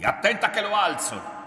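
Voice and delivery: high, loud, menacing warning; sudden aggressive yell; shouted threat. A narrator's reading. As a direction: threaten them at full voice. Son threatens to raise his hand.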